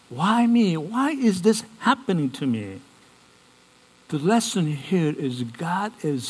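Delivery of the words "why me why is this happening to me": mildly acted plaintive cry, pitched higher; plaintive questioning. Believer asking themselves about bad times, mildly plaintive. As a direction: lightly voice a sufferer's higher-pitched protest.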